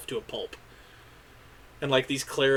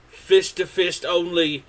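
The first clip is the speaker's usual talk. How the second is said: announcer rules; 4 semitones higher, 5 dB louder, much slower than usual. Acted. GM voices pit announcer.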